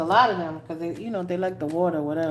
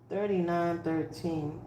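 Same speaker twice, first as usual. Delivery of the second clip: much softer and slower; quiet repeat; 3 semitones lower, 8 dB quieter than usual. Real, not acted. Reader repeating the numbers for Scorpio, soft and slow. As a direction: murmur the numbers again.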